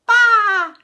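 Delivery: high, loud bleating baa; shrill sheep baa. Acted. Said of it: "said the sheep".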